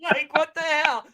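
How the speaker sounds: loud high hammed-up indignation; mock outrage. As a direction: rant like a cheated customer. Mock outrage at paid match.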